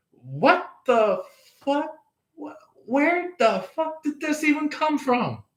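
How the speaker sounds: high, incredulous what-the-hell; reacting to a stranger's weird smile, shocked and high; shocked disbelief. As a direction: squeak it out in total disbelief.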